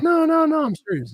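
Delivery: jumps way up high and loud on the no's, then settles; hammed-up squeaky denial, as if wronged; a retold, high-pitched burst of "no, no, no"; mock insistent protest. Bar guy insisting, retold.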